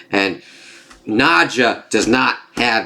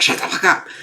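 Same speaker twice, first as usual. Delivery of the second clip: higher, breathless whispered put-down; mock whispered snap; 9 semitones higher than usual. The guide silencing the caged familiar, a whispered snap. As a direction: hiss it at him, mocking.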